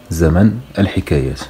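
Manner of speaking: normal talking voice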